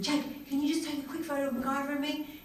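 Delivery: light, high sweet-talking; wheedling request. Retold or imitated. Sister asks younger brother for a photo, wheedling.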